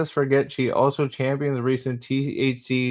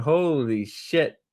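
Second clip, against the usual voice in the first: ordinary talk, nothing marked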